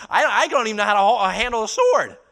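loud, high outburst; impassioned admission. Acted. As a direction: cry out helpless inexperience at full voice. Acting out David's admission of weakness.

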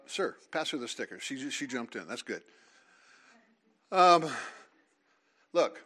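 ordinary talk, nothing marked

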